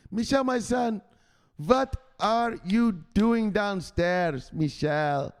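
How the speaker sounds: high, slow pleading mama; an imploring mother calling her son, slowly; mother's voice. Retold or imitated. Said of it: "his mama asks him".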